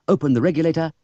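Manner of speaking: ordinary delivery, nothing marked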